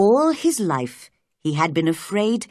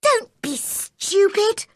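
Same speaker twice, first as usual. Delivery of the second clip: high, slow scolding; exasperated girl, pitched way up; cross cry. A narrator's reading. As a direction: cry out impatiently at him. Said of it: "cried Mary".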